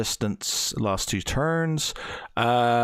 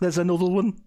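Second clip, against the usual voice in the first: higher Scouse elf call; imitating the Scouse elf again; 7 semitones higher than usual. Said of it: "Scouse elf".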